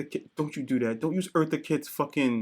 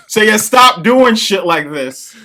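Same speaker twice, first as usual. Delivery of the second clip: loud scolding; 6 semitones higher, 12 dB louder, much slower than usual. Real, not acted. Mock scolding co-host.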